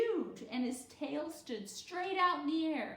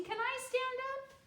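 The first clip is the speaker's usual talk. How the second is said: eager asking; 3 dB louder than usual. A narrator's reading. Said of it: "called Chelsea".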